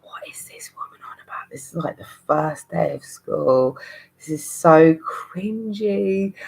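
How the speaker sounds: unvoiced whisper of disbelief; inner whisper. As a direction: whisper it as a mortified pupil.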